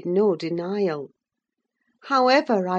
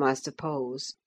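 usual speaking voice

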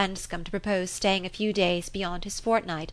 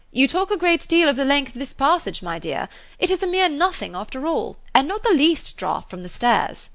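called out higher and louder down the passage; calling out; 7 semitones higher, 5 dB louder than usual. A narrator's reading. Mr Weston striding the passage, calling out to his wife. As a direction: call it across the passage. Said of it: "was calling out".

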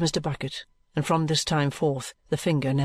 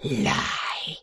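lower, unvoiced French denial; crying out; 3 semitones lower than usual. Maid contradicting the detective, hissed. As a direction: hiss the denial. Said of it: "cries mademoiselle".

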